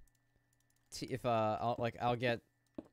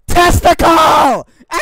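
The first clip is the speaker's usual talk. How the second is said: shrieked high and very loud; screamed punchline; 29 dB louder than usual. Pretend. Streamer screams the punchline of a chat joke. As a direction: scream the joke's payoff.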